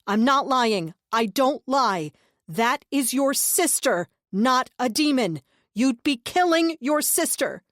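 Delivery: loud, slow, insistent plea; urgent pleading. A narrator's reading. Narrator urging the brother loudly and slowly. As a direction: press each word to break through. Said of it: "I tried again".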